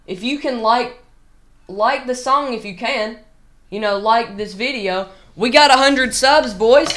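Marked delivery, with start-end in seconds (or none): 5.4-6.9 excited. Real, not acted. Celebrating hundred subs again.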